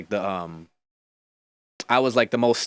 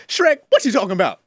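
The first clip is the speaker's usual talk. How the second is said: higher, louder, fast-talking Donkey; Eddie Murphy as Donkey; 5 semitones higher, 4 dB louder than usual. Shown as a demonstration. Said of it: "Here's the Eddie Murphy".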